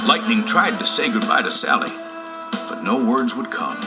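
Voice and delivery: ordinary delivery, nothing marked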